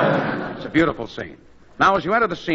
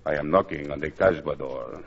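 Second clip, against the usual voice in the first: put-on French lover accent; 13 semitones lower, 7 dB quieter than usual. Continues French lover act.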